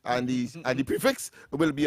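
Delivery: ordinary delivery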